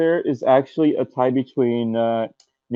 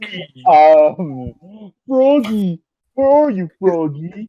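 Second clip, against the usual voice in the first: high calling voice; Big the Cat voice; 7 semitones higher, slower than usual. Retold or imitated. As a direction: call out for Froggy, higher.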